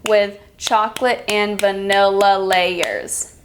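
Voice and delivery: slow, syllable by syllable, one beat per clap; slow clapped-out reading. Shown as a demonstration. Teacher clapping out a sentence for students, slow and even. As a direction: read it slowly like you're clapping each syllable. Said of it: "I'm going to clap out some sentences".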